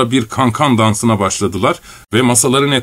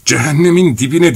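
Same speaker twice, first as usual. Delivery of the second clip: roaring; 5 semitones higher, 3 dB louder than usual. A narrator's reading. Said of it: "diye kükredi neden sonra".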